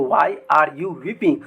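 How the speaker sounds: why are you weeping said very slowly; slow dictation. Shown as a demonstration. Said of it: "तो". (धीमी आवाज़ में धीरे बोलना)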